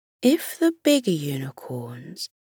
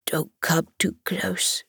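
soft unvoiced whisper with a stuffy cold; sniffly whisper; 6 dB quieter than usual. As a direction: whisper it like you have a cold. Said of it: "the hedgehog sniffed".

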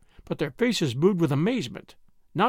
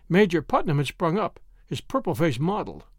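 ordinary delivery

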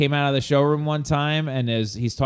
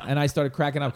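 ordinary talk, nothing marked

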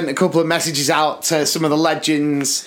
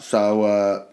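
lower, wary mock Cockney John; lower, flat Cockney John; 6 semitones lower than usual. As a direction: play the suspicious mate on the phone. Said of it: "he just was like this on the phone".

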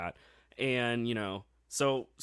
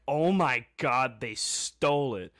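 stunned disbelief; 6 dB louder, slower than usual. Retold or imitated. Retelling shock at the steal.

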